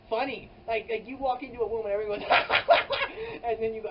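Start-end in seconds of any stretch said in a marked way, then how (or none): 2.3-3.4 loud, high forced cackle; a loud put-on laugh, a little evil; fake evil laugh. Shown as a demonstration. Audience faking a big laugh at a bad joke, loud high cackle. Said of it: "I'm not good at fake laughing".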